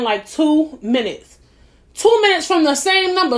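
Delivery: incredulous repetition of "two minutes"; stress on two minutes. Real, not acted. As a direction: lean hard on "Two minutes". Stressing the quick callback.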